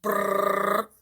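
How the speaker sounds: rolled brrr like a ringing phone; imitated phone ring.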